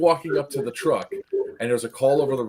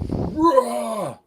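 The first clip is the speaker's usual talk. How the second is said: high mock roar; imitated monster roar; 12 semitones higher, 4 dB louder than usual. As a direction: roar like a charging creature. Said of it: "comes running out and just goes".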